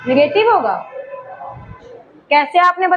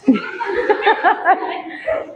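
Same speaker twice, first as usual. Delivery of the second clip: quiet unvoiced chuckle; teacher chuckling quietly at a student's answer; soft breathy laugh; 2 semitones higher, 4 dB quieter than usual. Real, not acted. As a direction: laugh softly under the breath.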